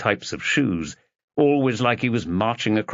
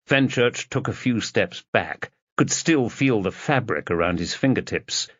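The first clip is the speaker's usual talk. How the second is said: usual speaking voice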